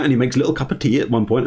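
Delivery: ordinary talk, nothing marked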